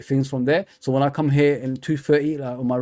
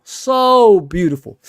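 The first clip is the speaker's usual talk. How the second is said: "So beautiful" drawn out slowly and louder; savouring admiration; 3 semitones higher, 6 dB louder, much slower than usual. Real, not acted. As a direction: linger on "So beautiful" like you're reliving it. Admiring Ronaldo, drawn-out repeat.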